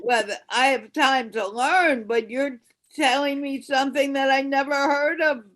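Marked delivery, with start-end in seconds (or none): none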